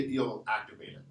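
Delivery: usual speaking voice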